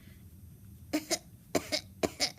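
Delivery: put-on hacking cough; acted sickly coughing fit; Grandma's sick cough. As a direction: cough repeatedly, feigning illness. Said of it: "Said Grandma".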